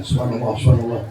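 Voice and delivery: whisper-soft night wiridan; murmured dhikr. Retold or imitated. Santri murmuring dhikr alone at midnight, softly.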